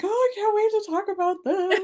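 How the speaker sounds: high-pitched, gushing eagerness; excited anticipation. Retold or imitated. Reader telling co-host she couldn't wait to chat, excited. Retelling eager reaction on seeing book.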